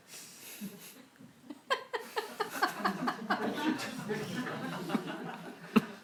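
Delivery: high-pitched burst of laughter; real amused laughter; hearty high laugh. Real, not acted. Laughs at his own parody.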